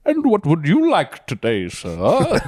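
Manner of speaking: a put-on posh fair vendor accent, hammed up; fake British accent. Describing fair workers' fake accents, mock British vendor voice. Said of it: "everyone putting on a fake".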